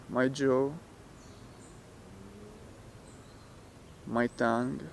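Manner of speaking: soft, unhurried meditation voice with long pauses; guided meditation. Real, not acted. Teacher talking listeners through relaxing the muscles, guided meditation. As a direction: guide them gently, leaving space after each phrase.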